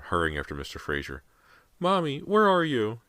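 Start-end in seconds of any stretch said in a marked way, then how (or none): 1.8-3.0 put-on high, slow, small child's cry; little boy crying from far away; tiny frightened child. A narrator's reading. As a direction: call out small and lost, like a scared little boy. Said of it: "James's cry sounded tiny".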